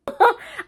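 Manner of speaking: high, loud wordless burst of laughter; short, high laughing cry of amazement; astonished outburst. Retold or imitated. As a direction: burst out in re-enacted shock at the ending. Wordless reaction to the ending.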